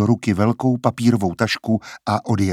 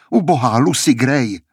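louder, pitying exclamation of Ubohá Lucy Gray; a touch louder lament for the girl; pitying cry; 3 semitones higher, 3 dB louder than usual. A narrator's reading. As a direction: cry out the name in pity. Cries out in pity, then echoed.

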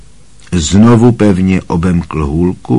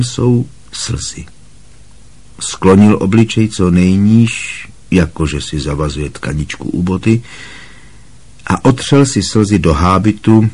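usual speaking voice

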